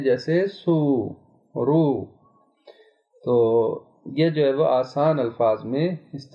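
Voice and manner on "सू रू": सू, रू with long oo held; drawn-out oo. Shown as a demonstration. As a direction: stretch the oo in सू and रू. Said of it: "वाव मद्दी कहते हैं". (वाव को खींचकर सू, रू)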